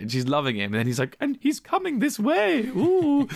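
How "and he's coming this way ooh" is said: high, slow old mum impression; hammed-up high voice, drawn out; imitating old lady. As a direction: coo excitedly like an old lady. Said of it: "old lady looking out the window being like".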